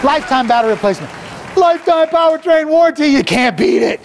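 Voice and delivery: car ad pitch at full throttle, racing the clock; hyped car-dealer pitch. Acted. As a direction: shout the deals like a manic auctioneer. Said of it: "I got a little excited out there".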